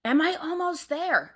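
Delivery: high, panicked yell down the cave. Retold or imitated. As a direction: call out desperately from the dark. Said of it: "And I'm yelling".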